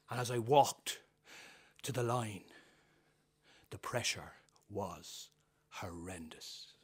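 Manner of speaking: dramatic storyteller narration; storytelling. Acted. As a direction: tell it like an old Irish tale. Performs personal story.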